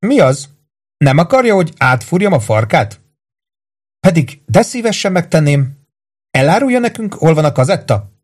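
high-pitched, teasing threat; mock-sweet interrogation; taunting torturer. Voices Carmel threatening the bound victim.

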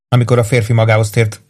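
plain reading voice

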